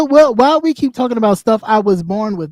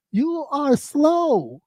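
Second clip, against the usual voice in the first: very slow, soft drawn-out words; deliberately slow; 5 semitones higher, 6 dB quieter, much slower than usual. Real, not acted. Mimicking slowness as insult.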